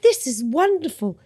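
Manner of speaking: light imitation of an English agent; a touch of an English accent, pleased. Imitating her English agent's delight, lightly English-accented. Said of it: "She was English, in case you didn't get that accent".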